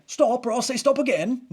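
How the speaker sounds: high, loud, hammed-up British policeman; terrible British accent. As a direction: badly imitate a British sketch-comedy bobby's warning. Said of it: "I know it was a terrible accent".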